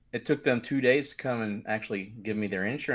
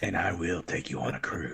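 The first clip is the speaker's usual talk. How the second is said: low, quiet creepy threat; 6 semitones lower, 4 dB quieter than usual. Retold or imitated. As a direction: say it like a soft menace. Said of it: "That sounded way too creepy".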